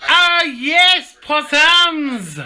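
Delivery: very high, drawn-out Australian falsetto; hammed-up Australian greeting. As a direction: trill it out like a grand Australian hostess. Australian figure hailing possums.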